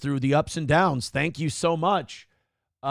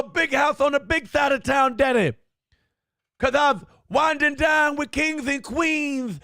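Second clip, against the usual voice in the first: much higher, louder hammed-up promo voice; mock wrestler promo; 8 semitones higher, 3 dB louder than usual. Parodying Rhodes Scholar reunion talk as a brash promo. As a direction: strut and shout like a showman. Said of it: "you actually have to talk like this".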